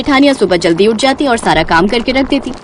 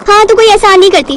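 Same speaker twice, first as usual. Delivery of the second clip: sarcastic retort; 8 semitones higher, 3 dB louder than usual. Acted.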